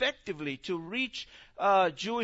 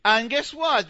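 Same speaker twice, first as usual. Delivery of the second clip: normal talking voice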